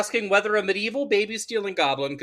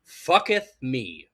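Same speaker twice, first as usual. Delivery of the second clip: quoting horror quip; 8 semitones lower, slower than usual. Retold or imitated. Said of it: "utters a line on par with the great horror quips".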